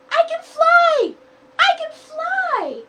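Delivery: high, loud, slow triumphant shout; joyful cry. Acted. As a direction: crow with delight at flying. Chick discovers she flies.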